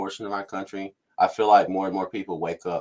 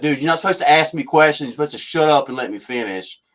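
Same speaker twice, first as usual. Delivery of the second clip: mock-snippy official, pitched up and loud at first, then settling; 3 semitones higher, 5 dB louder than usual. Government spokesman telling a reporter to shut up, snippy. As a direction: imitate a bossy official laying down the law. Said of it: "he's already getting snippy".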